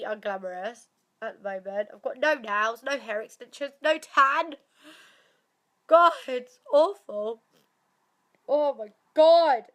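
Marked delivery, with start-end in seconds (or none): 5.9-9.6 louder, slow exasperated groan. Real, not acted. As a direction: drag it out in frustration. Frustrated complaint.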